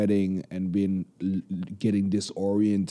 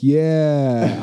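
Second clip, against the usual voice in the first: louder, drawn-out triumphant yeah; exultant cheer; 3 semitones higher, 5 dB louder than usual. Retold or imitated. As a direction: cheer it out like your big moment. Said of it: "supposed to be like".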